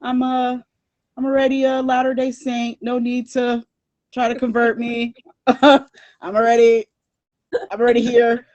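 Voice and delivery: mock sassy brush-off to missionaries. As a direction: wave them off with a laugh. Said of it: "I was like".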